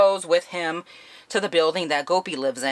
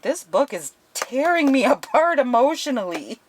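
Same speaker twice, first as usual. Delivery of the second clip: higher, laughing through the line, mock heartbroken; half-laughing, half-wailing over a sad book; overwhelmed by emotion; 8 semitones higher, 4 dB louder than usual. Retelling her own reaction to book.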